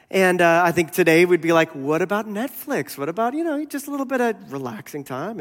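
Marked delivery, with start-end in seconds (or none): 1.8-5.4 high, wheedling imitation; mock pleading. People today asking for relaxing time, mock pleading. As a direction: angle for a little treat, higher voice. Voicing today's plea for leisure.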